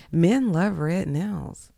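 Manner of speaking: usual speaking voice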